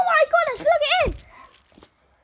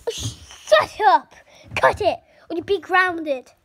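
loud scolding; pretend angry mom; 7 dB louder than usual. Mom scolding the fighting kids, loudly and angrily. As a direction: snap at the kids to stop.

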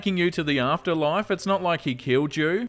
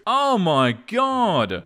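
awed gasp; 5 semitones higher, 3 dB louder, much slower than usual. Real, not acted. Awed reaction to sweet meme.

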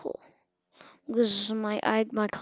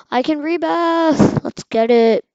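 higher, louder, drawn-out triumph; excited shout; 6 semitones higher, 10 dB louder than usual. Real, not acted. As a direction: cheer it, you just unlocked it. Thrilled at rebirthing.

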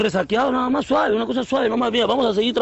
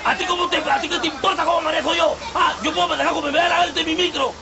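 higher-pitched, indignant outburst; angry retort; 5 semitones higher than usual. Acted. Driver defends his driving to rival, indignant yelling. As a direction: argue back hotly at another driver.

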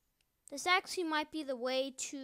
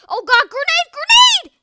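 panicked scream, much higher and louder; grenade warning yell; 11 semitones higher, 21 dB louder than usual. Real, not acted. Grenade incoming mid-battle.

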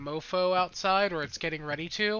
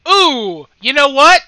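loud exclamation; 7 semitones higher, 17 dB louder than usual. Real, not acted. Loud reaction to game.

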